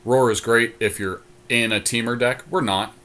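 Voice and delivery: ordinary talk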